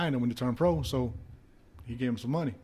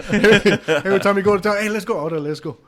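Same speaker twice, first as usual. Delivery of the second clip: much louder, high and eager; excited; 7 semitones higher, 10 dB louder than usual. Retold or imitated. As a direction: hype up the road trip. Excited driving around.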